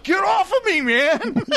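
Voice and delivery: high, laughing shout of protest; half-laughing high shout, shoving someone off; mock yell. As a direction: yell it while cracking up. Contestant yelling at the chunky.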